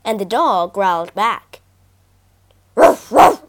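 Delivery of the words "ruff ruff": two loud, rough barks; sharp imitation woofs; dog barks. A narrator's reading. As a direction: bark like an angry dog. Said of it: "barked the dog on the bridge".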